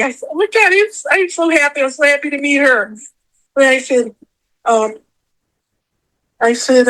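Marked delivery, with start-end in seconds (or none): 0.3-2.9 high-pitched overjoyed retelling; shocked and happy. Retold or imitated. Fan reliving meeting her idol, shocked and overjoyed. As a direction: relive the stunned delight, pitch jumping up. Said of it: "I was so shocked. I was so happy".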